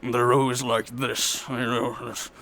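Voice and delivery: low, slow villain voice; Illidan. Shown as a demonstration. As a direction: drop deep and drag it out like an evil mastermind. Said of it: "whenever I need a villain".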